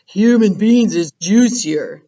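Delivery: a loud brutish giant voice; giant Blood Bottler. Acted. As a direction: play the mean giant.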